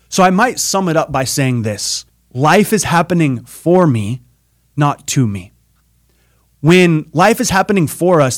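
loud, driving stage-speaker delivery; big keynote voice, every phrase pushed out loud; motivational preaching. Acted. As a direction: preach it to the whole arena at full force.

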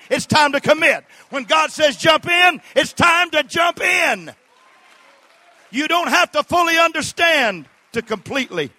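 high, loud, driving sermon shout; full-force preaching. Real, not acted. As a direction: preach it at the top of your voice.

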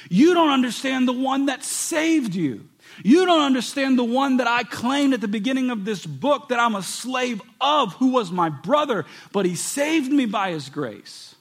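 loud, high pulpit preaching; fervent preaching. Acted. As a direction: preach it hard, pushing each line. Preaching at full force.

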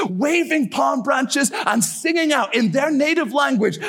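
high-pitched, rousing sermon climax; rally the church with impassioned, driving conviction; fervent preaching. Real, not acted. Preacher rousing a church for the harvest, fervent preaching.

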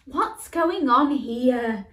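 loud, slow mum query; mum interjecting. Acted. Mum steps into the toy fight, loud and slow. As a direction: step in as mum. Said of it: "Mum interjected".

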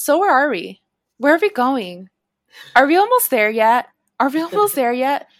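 put-on loud, high, nagging kid on a hike; whiny are-we-there-yet. Hikers nagging the guides every few minutes, loud and whiny. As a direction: pester the guides like a bored child. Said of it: "every five minutes".

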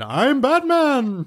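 high, slow mock Batman line; Batman impression. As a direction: drawl the famous line. Quoting Clooney, high slow.

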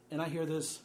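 ordinary delivery

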